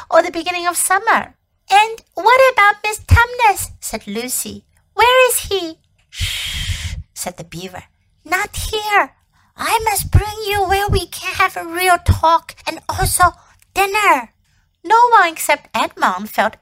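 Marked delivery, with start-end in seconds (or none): 1.7-5.8 put-on young girl voice. Lucy anxious question. 6.1-7.0 unvoiced warning hush; hushing shh. Beaver hushes. 8.2-14.3 lisping buck-toothed beaver. Acted. Beaver character voice.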